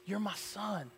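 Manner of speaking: very soft, intimate assurance; imitated divine whisper. God claiming each person in church, very hushed voice. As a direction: hush it right down, a pause between phrases. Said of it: "Spirit of God whispering that to you".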